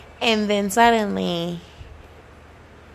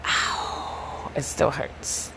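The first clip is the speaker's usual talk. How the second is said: whispered mock hurt; 5 semitones lower, 6 dB quieter, much slower than usual. Mock pain at the rejection.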